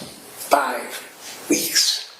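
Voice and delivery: "Five ... weeks", each word set apart; emphatic repeat. Real, not acted. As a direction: land FIVE hard, pause, then weeks. Repeats figure slowly for emphasis.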